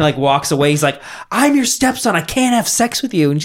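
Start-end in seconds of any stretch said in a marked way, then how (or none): 1.3-3.3 high-pitched parody protest; mock exasperated stepson. Stepson refuses his stepmom in a parody, mock exasperated. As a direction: push back in hammed-up disbelief.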